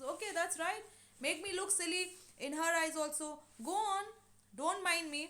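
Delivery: sarcastic go-ahead, pitched well up; bitter. Acted. Husband made to look silly before the neighbor, bitter. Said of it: "he is bitter".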